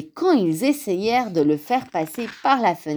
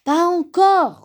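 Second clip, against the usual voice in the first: high, loud, drawn-out protest; exasperated griping; 11 semitones higher, 6 dB louder than usual. A narrator's reading. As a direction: snap back, losing patience. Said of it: "rouspéta Grand Ours". (rouspéter, exaspéré)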